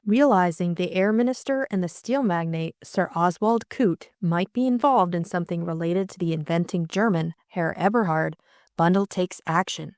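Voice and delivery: ordinary delivery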